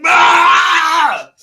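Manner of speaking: sudden high yelled "Ah!" imitating the moment; mock scream. Guest acting out his night with a loud mock scream. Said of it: "jamming it in there like".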